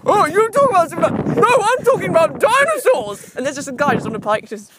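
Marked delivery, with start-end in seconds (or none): 0.0-1.3 high, louder mocking voice; mock voice quoting a friend. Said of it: "We're quite pathetic going". 1.4-3.4 high, loud mock whine. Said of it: "We're quite pathetic going".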